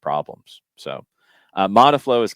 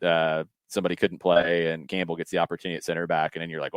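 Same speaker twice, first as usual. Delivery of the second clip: ordinary delivery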